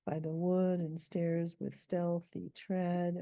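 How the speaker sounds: slow, metered reading with each beat marked; scanning the meter. Shown as a demonstration. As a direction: read it out beat by beat. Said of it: "his stealthy tread is in iambs".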